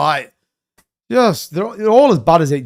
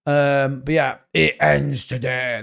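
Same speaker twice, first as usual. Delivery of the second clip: ordinary talk, nothing marked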